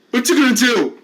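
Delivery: bully goading a patient to hit him; loud taunt. Acted. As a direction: jeer the dare in his face.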